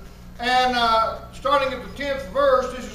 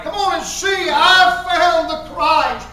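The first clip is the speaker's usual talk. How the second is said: loud, raised voice, stretched-out final words; excited testimony; 3 semitones higher, 7 dB louder, slower than usual. Acted. Believer telling somebody of finding the Christ, excited and loud. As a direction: proclaim it with mounting excitement. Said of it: "to get that excited".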